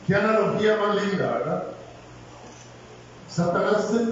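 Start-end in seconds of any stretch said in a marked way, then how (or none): none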